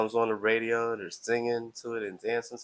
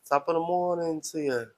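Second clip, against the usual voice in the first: attempted Irish accent, higher pitched; 6 semitones higher than usual. Shown as a demonstration. Streamer showing viewers his accents, attempted Irish. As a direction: put on a lilting Irish accent. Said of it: "That was an Irish".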